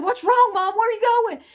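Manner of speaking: put-on high, loud startled question from a frightened girl; alarmed daughter. Mother retells her startled daughter, a high loud alarmed cry. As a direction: blurt it out like a scared kid. Terrified daughter asks what's wrong.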